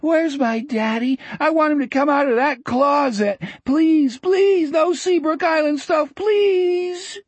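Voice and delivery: put-on very high, begging child voice; high-pitched pleading, rising in desperation; distraught boy dreaming. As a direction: beg like a frightened little boy. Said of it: "Richard was muttering in his sleep".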